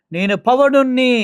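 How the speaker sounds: slow booming mock hero line; mock Pawan speech. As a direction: ham up the star's pompous line, slowly. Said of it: "అని ఆయన మాట్లాడిన మాటలు".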